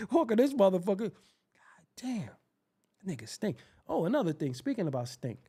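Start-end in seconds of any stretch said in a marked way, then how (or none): 1.1-3.6 hushed aside at the stench; breathy under-the-breath curse; disgusted whisper. Acted. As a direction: whisper it, repulsed.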